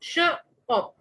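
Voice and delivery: श, ऑप spoken as two separate beats with a pause between; syllables pulled apart for the class; split into two beats. Shown as a demonstration. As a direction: break श and ऑप apart like a teacher. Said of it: "word दो beats में टूट रहा है".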